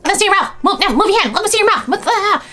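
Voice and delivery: very high, loud, frantic mock orders; mimicked bossy mother. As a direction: imitate a mother shrilly directing a child. Mimicking mother posing crying child.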